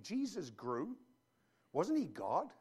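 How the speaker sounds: mock doubter asking back, quiet rising; puzzled question. Pastor voicing a doubting listener, mildly puzzled question. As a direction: echo it back as a baffled skeptic. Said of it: "Whoa, whoa, whoa, what do you mean?".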